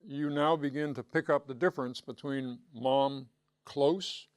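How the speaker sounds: ordinary delivery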